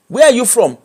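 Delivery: usual speaking voice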